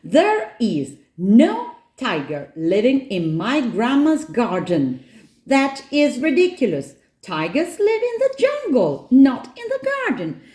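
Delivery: put-on high, insistent child's protest; exasperated shout. A narrator's reading. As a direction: argue indignantly, pitch climbing. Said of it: "shouts Nora".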